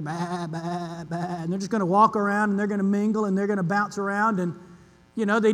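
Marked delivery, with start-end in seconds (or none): 0.0-1.2 soft, low mock sheep bleating. Preacher imitating sheep in the pen, soft low bleat. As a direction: bleat gently like a milling sheep. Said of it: "They're gonna go".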